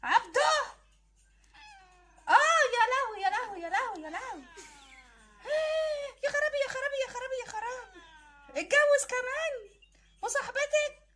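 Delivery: high, crying wail of "يا لهوي"; keen like a wronged wife; wailing lament. Acted. Wife wailing over her husband's new marriage, high and crying.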